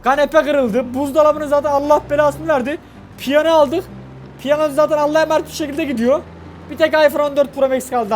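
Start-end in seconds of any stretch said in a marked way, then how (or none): none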